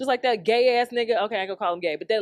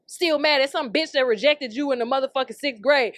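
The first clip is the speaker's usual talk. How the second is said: ordinary talk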